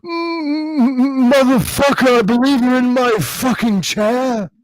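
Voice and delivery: [name] Osbourne. Retold or imitated. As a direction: start on a hum, then blurt it high and indignant. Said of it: "And [name] replied with".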